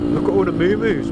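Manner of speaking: normal talking voice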